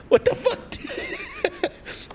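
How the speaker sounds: squeaky, very high voice breaking into laughter; helpless laughing disbelief. Acted. Baffled emigrant to himself, helpless laughing.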